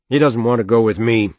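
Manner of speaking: mildly exasperated retort; irritable. A narrator's reading. Father refusing to take the sick boy, irritable. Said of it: "She saw that he was irritable".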